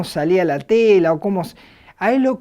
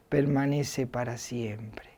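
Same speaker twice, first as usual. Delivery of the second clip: hushed whisper; 7 semitones lower, 10 dB quieter than usual. Acted. Closing a scripture quote.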